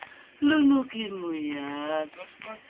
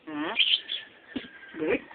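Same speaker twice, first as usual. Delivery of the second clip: usual speaking voice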